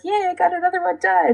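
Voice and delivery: high-pitched mock cheer. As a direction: cheer yourself on, half-playful. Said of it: "it's like".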